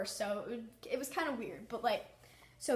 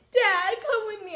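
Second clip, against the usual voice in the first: frightened plea; 9 semitones higher, 10 dB louder than usual. Retold or imitated. Scared teen pleading with dad.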